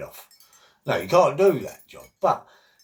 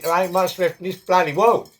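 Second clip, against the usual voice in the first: higher, louder cockney grumble; Alf Garnett impression; 6 semitones higher, 4 dB louder than usual. Doing the old sitcom bigot for the viewers, louder and higher. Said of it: "doing it, giving Alf Garnett".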